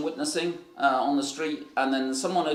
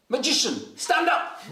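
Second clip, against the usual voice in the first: high, barked imitation of an order; mock summons, pitch leaping up; sudden command; 10 semitones higher, slower than usual. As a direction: call someone to their feet abruptly. Father's sudden command acted out.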